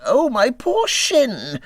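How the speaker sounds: high, slow pained cry. A narrator's reading. Mole cries out after tripping, high pained wail. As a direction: yelp it out as the hurt leg throbs. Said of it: "he cried".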